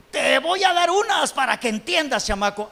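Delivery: high-pitched exasperated parent scolding; mock parental threat. Acting a parent whose kid won't understand, mock scolding.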